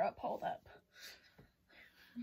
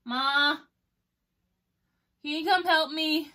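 loud call to another room; 4 semitones higher, 11 dB louder than usual. Real, not acted. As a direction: call out for mom. Calling mom from another room.

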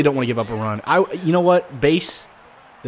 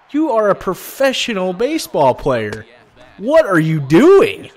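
louder, higher, slow "what are you doing"; exasperated yell; 6 semitones higher, 6 dB louder than usual. Real, not acted. Frustrated gamer yelling at his baseball player, slow angry shout. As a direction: scold the player as if he can hear you. Scolding his own fielder.

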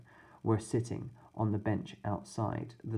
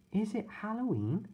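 put-on high curious little-boy question; little brother asking the babysitter; small child voice; 12 semitones higher than usual. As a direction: ask it like an innocent toddler.

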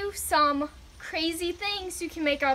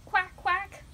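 duck voice; 4 dB quieter than usual. Pretend. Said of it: "Quack like a duck".

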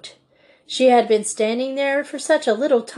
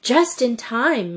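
plain reading voice

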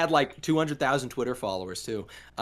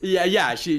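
ordinary talk, nothing marked

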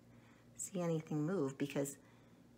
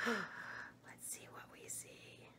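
whisper; 3 semitones higher, 13 dB quieter, slower than usual. Real, not acted. Peeking at egg in dark.

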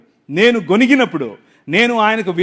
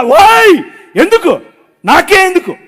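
high, loud anguished cry; shouting at God; 10 semitones higher, 12 dB louder, slower than usual. Retold or imitated. Pastor on the roadside crying out to God, high loud shout. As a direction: yell up at heaven in despair. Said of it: "గట్టి గట్టిగా అరుస్తున్నా".

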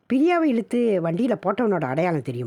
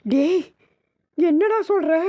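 shocked cry; 11 semitones higher, 4 dB louder than usual. Acted. Mother hears daughter kidnapped.

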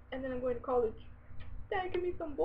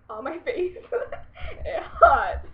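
real laugh breaking through, pitched up and loud; loud, high giggling through the words; 6 semitones higher, 12 dB louder, slower than usual. Real, not acted. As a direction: laugh helplessly while admitting it hurt. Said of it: "I don't know why I'm laughing".

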